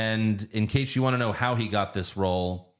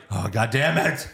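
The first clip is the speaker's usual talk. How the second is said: mock exasperated. Batman giving up, exasperated.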